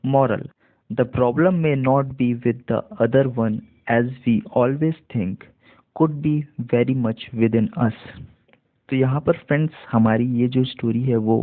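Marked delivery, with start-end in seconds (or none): 0.1-8.1 slow demo reading. Shown as a demonstration. Teacher reads the moral to learners, very slow and clear. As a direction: read the moral very slowly, word by word. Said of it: "पूरा देख लेते हैं इसे".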